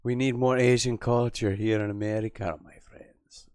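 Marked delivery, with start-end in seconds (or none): 2.6-3.5 whispered aside. Acted. Sarcastic tag whispered.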